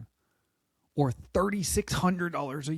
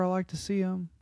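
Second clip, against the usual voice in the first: weird voice; 2 semitones higher than usual. Retold or imitated. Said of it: "I keep getting that weird voice right there".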